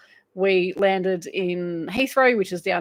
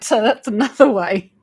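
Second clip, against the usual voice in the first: usual speaking voice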